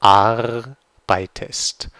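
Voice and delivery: "Arbeitest" said very slowly, AR-bei-test, with an untrilled r; German pronunciation. Shown as a demonstration. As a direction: spell out "Arbeitest" slowly syllable by syllable. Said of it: "German pronunciation demo".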